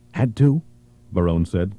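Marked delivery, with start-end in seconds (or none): none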